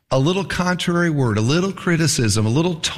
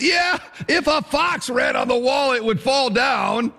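eager jeer; 8 semitones higher than usual. Retold or imitated. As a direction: jump in to top the taunt. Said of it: "he goes".

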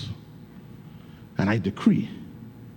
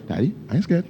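put-on higher, brave little girl's voice; voicing young daughter; 5 semitones higher, faster than usual. Father voicing his daughter saying she's not scared, higher. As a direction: show her pride at being brave.

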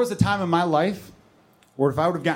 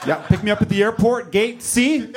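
stern phone order; 5 semitones higher than usual. Acted. Acts parent's curt pickup phone call.

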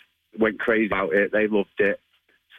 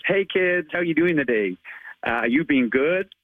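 higher, a light attempt at a cheery American accent; American Spider-Man accent; 6 semitones higher than usual. Shown as a demonstration. Spider-Man jogger greets neighbourhood kids, higher, in a light American accent. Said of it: "So what I normally say is".

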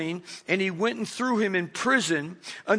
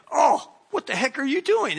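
indignant outcry; 7 semitones higher than usual. Pretend. Imagined victim kicked in shin.

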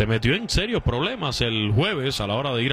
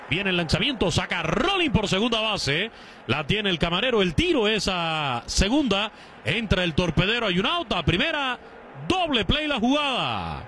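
higher, excited baseball call; live play-by-play; 6 semitones higher than usual. Real, not acted. Announcer calling a live double play to listeners, excited play-by-play. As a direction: call the double play as it unfolds, pitch climbing.

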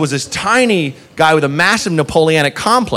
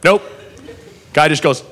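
ordinary talk, nothing marked

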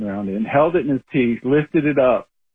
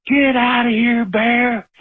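full shout at a bear; 10 semitones higher, slower than usual. Retold or imitated. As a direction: shout it high and hard, making yourself big. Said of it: "and I yelled".

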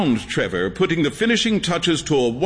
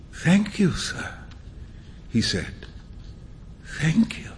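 barely voiced, fading thanks; faint whisper; 5 dB quieter than usual. A narrator's reading. Beggar thanking Huey for the coin, whispered. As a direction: breathe it out, almost soundless.

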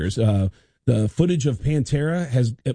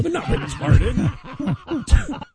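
very high, slow, laughing correction; squeaky laughing objection; laughing protest; much slower than usual. Retold or imitated. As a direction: laugh while setting him straight. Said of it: "I go".

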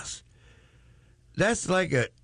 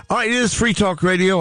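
ordinary talk, nothing marked

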